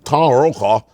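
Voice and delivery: low, garbled newscaster voice; Tom Brokaw. Retold or imitated. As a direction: gargle the words like a low-voiced anchorman. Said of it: "He's gargling his mouth".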